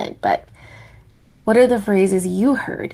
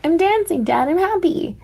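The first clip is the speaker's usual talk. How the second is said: put-on much higher, chirpy childlike voice; happy little penguin; 10 semitones higher than usual. Mumble answering his father, high and gleeful. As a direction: sound like a delighted newborn chick.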